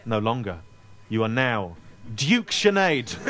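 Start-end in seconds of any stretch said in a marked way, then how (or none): 1.1-3.2 slow grand proclamation; mock ceremony. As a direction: knight her slowly. Mock ceremonial title bestowed.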